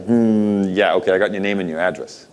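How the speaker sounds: normal talking voice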